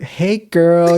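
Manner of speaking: mock flamboyant greeting. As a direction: sing it out camp. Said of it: "they talk like this".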